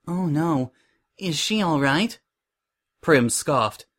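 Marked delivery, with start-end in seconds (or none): 0.1-2.2 higher, gentle voice of concern; sweetly concerned Suri. A narrator's reading. Suri asks Prim about the model, sweetly concerned. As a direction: ask with sugary sympathy.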